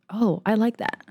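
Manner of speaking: ordinary talk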